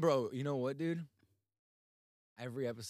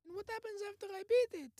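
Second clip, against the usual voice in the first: put-on kid voice; 6 semitones higher, 4 dB quieter than usual. Plays son to dad.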